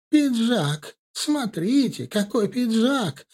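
put-on high, creaky old lady hawking; creaky old woman voice. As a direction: croak it out like an old market granny. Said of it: "кричала она скрипучим голосом".

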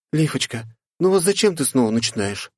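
put-on high, soothing young woman; gentle girl voice. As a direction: coax a friend gently. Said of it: "Приблизилась к ней Катя и обняла". (ласково, высоким голосом)

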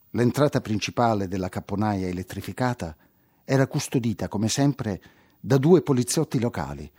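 ordinary delivery, nothing marked

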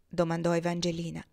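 usual speaking voice

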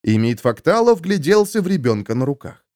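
plain reading voice

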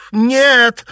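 very high, loud cry; piercing denial; shrieking. A narrator's reading. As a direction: shriek out in horror. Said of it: "завизжала Наташа".

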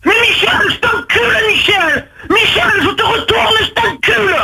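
high-pitched, drawn-out yelled insults; real furious shouting. Real, not acted. Brother threatens Michel des planchettes, furious shouting. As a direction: hurl abuse down the phone.

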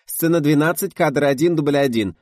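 high, loud clapperboard announcement; businesslike slate call. A narrator's reading. Assistant announces the take at the clapperboard, businesslike. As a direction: rattle off the slate in an official voice. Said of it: "деловито зачастила".

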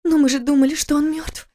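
высокий женский голос, мягко возражает; жена успокаивает мужа; жена оправдывает. Acted. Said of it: "попыталась оправдать его Кира". (высоко, мягко)

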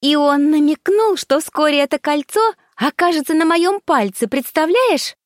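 high squeaky voice. Acted. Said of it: "пропищала".

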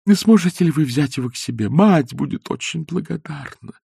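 робкая, выше обычного просьба; проси неуверенно, с запинками; робко. A narrator's reading. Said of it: "сказала она робея". (робко, выше и тише)

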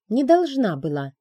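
defiant answer to the dragon; defiant retort. A narrator's reading. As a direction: fling it back, chin up. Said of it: "вскинула подбородок я".

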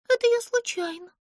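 high, bashful little voice; shy Pimpochka. A narrator's reading. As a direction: say it sheepishly, embarrassed. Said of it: "засмущалась Пимпочка". (смущённо, высоким голоском)

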